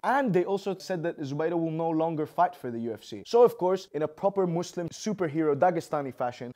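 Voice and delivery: ordinary talk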